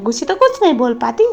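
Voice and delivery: ordinary talk, nothing marked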